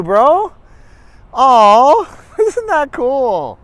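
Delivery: high, loud, gushing congratulations; excited gushing. Retold or imitated. Aunt gushing loudly to Mark about family visit. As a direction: gush over him like a thrilled aunt. Cousin's excited greeting voiced.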